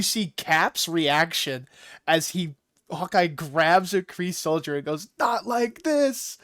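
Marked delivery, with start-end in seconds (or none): 5.2-6.4 hammed-up heroic cry, stretching every word; Hawkeye's mock scream. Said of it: "he screams".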